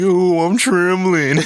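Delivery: high, drawn-out fake trembling; taunting falsetto, slow and teasing; mocking quoted voice. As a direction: stretch it out in a high mock-scared voice. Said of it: "he would use that voice".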